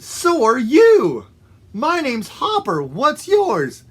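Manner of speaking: high, upbeat storybook frog; friendly excitement. Acted. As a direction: introduce yourself to a new pal. Frog introduces himself to friend.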